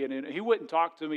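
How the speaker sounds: ordinary talk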